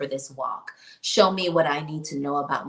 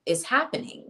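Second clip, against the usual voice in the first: ordinary delivery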